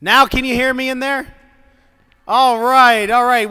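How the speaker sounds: loud calling out; calling down a hallway. Real, not acted. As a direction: call out to the next room. Principal checks mic to room.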